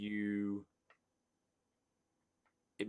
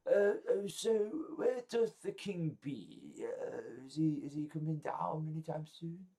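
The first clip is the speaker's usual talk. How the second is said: high, hesitant guest voice, fading softer; inquisitive party guest; 4 semitones higher, 5 dB quieter than usual. Acted. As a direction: pipe up high and politely puzzled, then trail softer. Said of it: "a few people asking".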